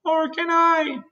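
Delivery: very high glowworm wail. A narrator's reading. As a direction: wail "Nor can I" helplessly, high-pitched. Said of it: "wailed the glowworm".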